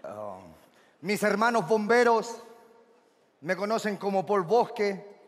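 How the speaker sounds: low, measured seducer; low heartthrob patter; suave galán introduction. Acted. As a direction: introduce yourself deep and smooth.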